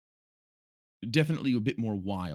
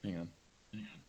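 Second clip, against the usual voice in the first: ordinary talk, nothing marked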